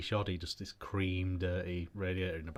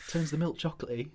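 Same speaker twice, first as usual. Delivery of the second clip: marketing pitch; 6 semitones higher than usual. Retold or imitated. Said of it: "and went".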